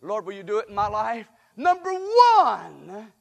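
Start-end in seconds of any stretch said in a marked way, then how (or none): 1.6-3.1 booming announcement. Real, not acted. Big announcement of point.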